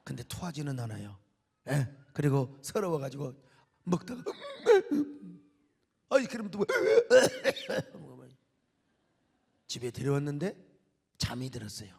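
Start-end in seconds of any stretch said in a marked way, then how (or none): 4.3-5.3 a high laugh breaking out; real laugh, high and loud; burst of laughter. Real, not acted. Laughing at his son's story. 6.7-8.0 a high laugh breaking out; real laugh, high and loud; burst of laughter. Real, not acted. Laughing at his son's story.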